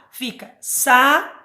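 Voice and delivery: "sa-u-d" spoken slowly, each syllable cut apart with small gaps; teacher spelling out the syllables for the class; split into syllables. Shown as a demonstration. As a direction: break saúde into sa, u, de, one beat each. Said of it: "Mas quando eu separo".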